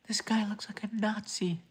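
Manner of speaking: high, whispered mockery; high mocking whisper about a viewer's photo.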